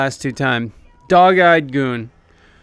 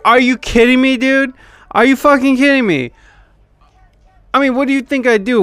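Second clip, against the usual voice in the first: incredulous outburst; 8 semitones higher, faster than usual. Retold or imitated. Retold outburst at door guard.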